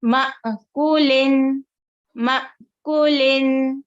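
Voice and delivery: फ़तू very slow, with a small stop before तू; tiny jolt in the middle of फ़तू; slight catch on hamza. Shown as a demonstration. Teacher shows students the stop at hamza sakina. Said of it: "second line में देखिए".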